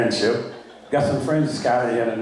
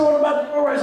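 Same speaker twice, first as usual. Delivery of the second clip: pressing offer; 11 semitones higher, faster than usual. Retold or imitated. Retold dialogue, pressing offer again.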